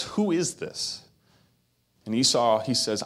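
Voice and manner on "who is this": higher, slow, stunned question; old father stunned to hear his son; shocked. Acted. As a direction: let the shock slow the words down. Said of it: "And Isaac in shock says".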